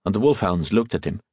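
ordinary delivery

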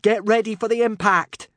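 high, loud call; urgent warning. Acted. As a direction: call it out to brace them. Hiccup warns of coming impact.

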